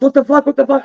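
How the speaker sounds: high, panicky repeated question, acted; retold startled alarm. Cook dodging spat-out ham, startled alarm. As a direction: replay your own alarm in a high voice.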